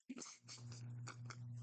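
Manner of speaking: short burst of real laughter. Real, not acted. Reader laughing at his own T-Spheres joke.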